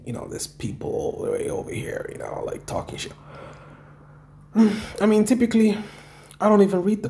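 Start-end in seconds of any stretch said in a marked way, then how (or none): 0.1-3.1 hushed whispered aside; whispering. Real, not acted. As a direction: drop to a whisper, confiding. Aside about the haters.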